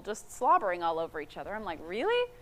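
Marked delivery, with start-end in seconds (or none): 1.8-2.3 high-pitched, disbelieving questions; squeaky-high incredulity; incredulous. Retold or imitated. Acts out disbelief at clingy couples.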